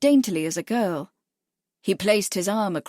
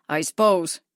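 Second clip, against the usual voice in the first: sulky; 3 semitones lower than usual. A narrator's reading. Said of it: "was sulky".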